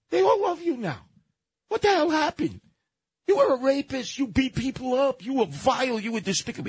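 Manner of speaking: squeaky high voice, hammed-up; mock high-pitched voice. Retelling a Tyson story to a talk-radio colleague, mock high-pitched voice. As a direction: pitch way up and play the retold speaker. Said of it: "And he tells Mel Gibson".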